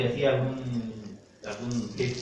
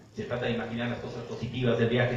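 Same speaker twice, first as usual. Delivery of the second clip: ordinary delivery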